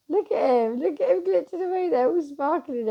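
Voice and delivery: thrilled look-at-him; excited. Real, not acted. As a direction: gush over him with real delight. Showing viewers her glitcher excitedly.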